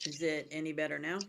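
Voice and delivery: faint, mic too low; barely audible. Real, not acted. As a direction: voice lost in the mic. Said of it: "No, that was worse".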